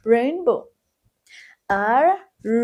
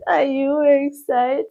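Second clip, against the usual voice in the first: ordinary talk, nothing marked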